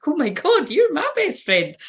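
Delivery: high, slow, starry-eyed gush. Pretend. To her twin flame, a dreamy high gushing reply. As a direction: swoon it out like a devoted fan. Gushing quote she wished she'd said.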